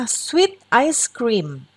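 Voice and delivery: sweet ice cream stretched out very slowly; teacher modelling sweet ice cream; slow model reading. Shown as a demonstration. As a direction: read sweet ice cream at a crawl for the children. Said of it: "teacher models phrase".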